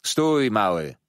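loud, commanding halt; calling out. A narrator's reading. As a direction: call a smaller boy to stop. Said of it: "zawołał, wychodząc z ławki".

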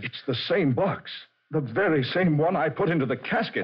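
the horror of realizing it's the same box; shocked disbelief. Acted. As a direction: voice climbing in alarm. Realizes the casket box is here.

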